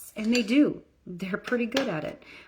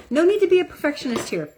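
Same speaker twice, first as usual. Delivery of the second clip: mock careless; 9 semitones higher, 6 dB louder, faster than usual. Mocking careless makeup person.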